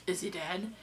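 quiet, flat and unfeeling, no concern; imitating the stranger's unsettling cold tone; cold flat question. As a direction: ask it like it means nothing to you. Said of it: "when he said it, I had this really unsettling feeling".

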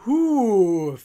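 long, loud falling "huuu" howl; storybook wail, drawn out and loud; witch's howl. A narrator's reading. Witch shut in the oven, howling. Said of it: "fing sie an zu heulen".

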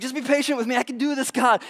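high, insistent acted plea; pleading believer. Believer promising God he can manage, high mock pleading. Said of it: "Sometimes we do this with God".

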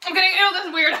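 high, loud flustered backpedal; squealing embarrassment. Real, not acted. Taking back her joke to viewers, high and flustered.

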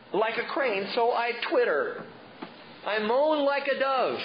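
hammed-up whimpering; twittering moan. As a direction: moan like a dove. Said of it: "He was twittering and moaning".

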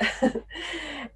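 soft laugh. Real, not acted. Laughs at kid's couch answer.

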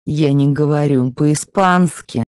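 normal talking voice

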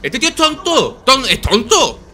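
high frustrated shouting; exasperated yell. Real, not acted. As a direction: yell at the screen. Frustrated yelling at game.

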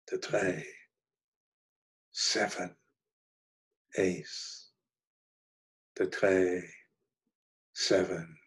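low monotone, each word alone with long pauses; monotonous murmuring. A narrator's reading. Mad Hermann in the hospital repeats his cards, monotonous murmur. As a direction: drone the cards one by one, as if entranced. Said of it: "murmuring in a monotonous tone".

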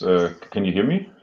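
usual speaking voice

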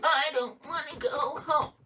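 high, whiny pout; pouting doll. Acted. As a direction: pout like a doll that won't leave. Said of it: "And pout here".

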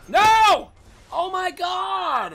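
loud high "no" into a drawn-out groan; high, stretched cry of defeat; dismayed wail. Real, not acted. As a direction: wail in real frustration. Dies in game.

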